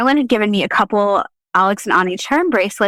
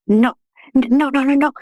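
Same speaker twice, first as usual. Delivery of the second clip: higher, half-mock panicked refusals; frantic no; 7 semitones higher than usual. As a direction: retell the panic, pitched up. Refusing the ghost at night.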